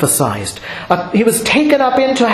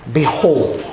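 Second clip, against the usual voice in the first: reverent behold; 6 semitones lower than usual. Shown as a demonstration. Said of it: "reverent sounding way".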